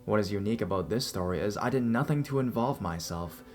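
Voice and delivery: ordinary delivery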